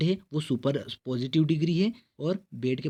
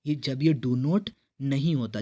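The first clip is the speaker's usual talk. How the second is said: normal talking voice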